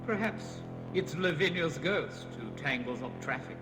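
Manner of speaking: ordinary delivery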